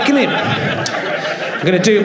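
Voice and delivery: ordinary delivery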